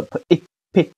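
p, then short 诶, blended into p; teacher demonstrating the short syllable p; short-vowel sounding-out. Shown as a demonstration. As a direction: sound out p、诶 in separate beats. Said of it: "就读它的短音".

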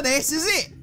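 usual speaking voice

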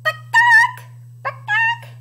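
very high "bakak"; high imitation hen call; chicken squawk. Shown as a demonstration. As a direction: cluck like a proud hen. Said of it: "Can you make that bakak sound?".

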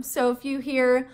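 usual speaking voice

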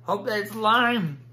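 slow, loud hopeful plea; pleading. Real, not acted. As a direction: drag it out, begging luck. Hoping for good flavor.

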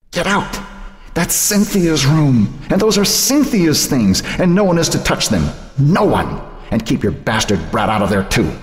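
guard the dead daughter's room fiercely; furious grandmother. A narrator's reading. Grandmother ordering Joan out of Cynthia's room, furious.

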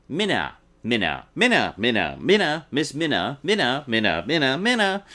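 Minna chanted over and over with a short i, MIN-uh; mocking mispronunciation. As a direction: repeat Minna mockingly, pitch jumping around. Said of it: "pronounced it Minna".

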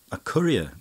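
loud, high amused question; a disbelieving laughing cry. A narrator's reading. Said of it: "cried Mascari, laughing".

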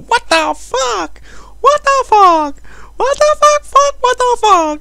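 very high falsetto squeal; squeaky mock cheer squad; little girl cheerleaders. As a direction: chant it like a troupe of swearing little girls. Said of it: "a whole fucking cheerleader troupe of them".

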